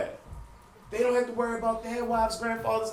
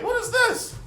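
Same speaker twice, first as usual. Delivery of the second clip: high-pitched startled "what is this"; shocked yelp; 3 dB louder, slower than usual. Retold or imitated. Man finding strange new underwear, shocked high yelp. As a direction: squeak it out in disbelief.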